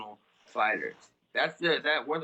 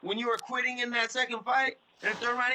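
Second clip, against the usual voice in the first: higher, scornful challenge; pitched-up scoffing comeback; heated retort; 9 semitones higher than usual. Real, not acted. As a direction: throw it back at him with contempt. Heated rant at rival fighter.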